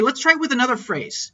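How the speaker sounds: ordinary delivery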